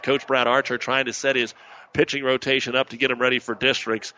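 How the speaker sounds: normal talking voice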